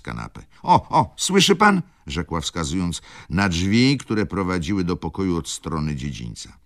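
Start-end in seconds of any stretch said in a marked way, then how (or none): 0.6-1.8 high, loud startled alarm; agitated baroness. A narrator's reading. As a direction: cry out, urging him to listen. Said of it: "krzyknęła baronowa". (wzburzony, podniesiony głos)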